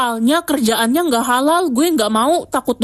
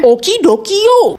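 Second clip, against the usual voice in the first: high, loud, playful sign-off; cheery okay; 7 semitones higher, 6 dB louder than usual. Acted. Swimmer told there is breath left, cheering playfully. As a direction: sing out a relieved okay. Relieved swimmer answers Ren.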